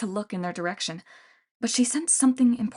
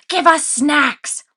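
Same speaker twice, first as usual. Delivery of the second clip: loud, high, slow monster bellow; bellowing Cyclops demand; 7 semitones higher, 9 dB louder, much slower than usual. A narrator's reading. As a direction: demand food like a spoiled giant. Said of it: "she bellowed at the store".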